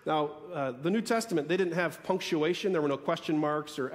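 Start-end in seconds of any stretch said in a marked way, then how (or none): none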